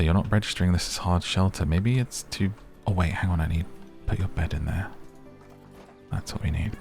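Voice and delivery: ordinary talk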